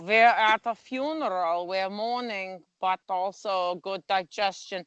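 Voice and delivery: put-on pragmatic German mourner in a shaky accent; bad German accent. Joker voices Germans at a funeral, bad German accent. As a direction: attempt a German accent, badly. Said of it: "can't even really do a German accent".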